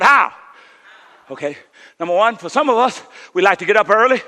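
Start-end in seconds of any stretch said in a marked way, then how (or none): 0.0-0.3 called out how. Shown as a demonstration. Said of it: "Everybody shout".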